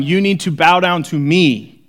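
mock arrogant demand; arrogant self-proclaimed messiah. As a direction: play a pompous preacher demanding worship. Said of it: "like me getting up here and going".

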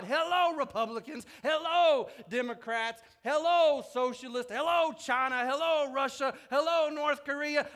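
loud, defiant hellos; calling out. Acted. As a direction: hail the rulers of the world one by one.

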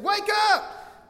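very high, full-force acted yell; hammed-up shout over a gale; shouting over wind. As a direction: yell to wake a sleeper in a storm. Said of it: "the wind is so loud they can't hear him".

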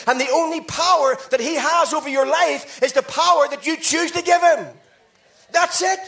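high, loud rapid-fire call and answer; full-force preaching. Acted. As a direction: preach it hard, driving each line home.

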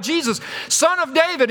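high-pitched acted call. Beggar calling Jesus through the entourage, high cry. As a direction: call a name to stop someone in a crowd. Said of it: "hears his name called out".

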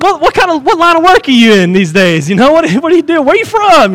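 high, casual chit-chat voice; mock small talk. Sailors get to know everybody before they die, mock chatty. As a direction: imitate breezy getting-to-know-you questions.

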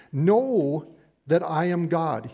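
Know leaned on, slow and deliberate; stress on Know. Shown as a demonstration. Pastor presses the verse on the congregation, stressing "Know". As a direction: land hard on "Know", then slow through the verse.

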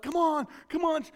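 high-pitched re-enacted urging; urgent cheering. Uncle urging niece about to score, high excited cheer. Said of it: "they're about to score, so I was like".

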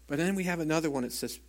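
ordinary delivery

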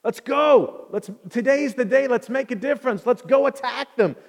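high, loud battle pep talk; fired-up rallying. Acted. Jonathan tired of hiding, rallying his armor bearer, fired up. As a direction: rouse your companion to charge. Acting Jonathan's bold faith before attack.

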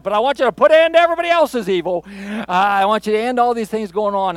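high, fast, loud pleading with God; a believer demanding God end others' evil, urgently pleading; pleading outburst. Retold or imitated. Urgent pleading outcry. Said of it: "Kinda like".

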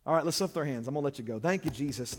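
ordinary talk

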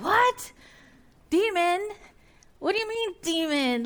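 high, startled "What? Demon?"; shocked listener. Pretend. Preacher voicing a startled congregant, high shocked squeak.

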